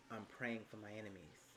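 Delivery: a soft, mocking take on a pious excuse; hushed parody of a churchy excuse; mocking quote. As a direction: quote it back with quiet sarcasm. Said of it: "under the guise of".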